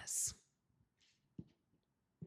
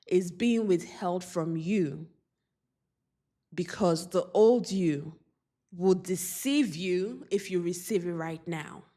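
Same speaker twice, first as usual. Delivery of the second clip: usual speaking voice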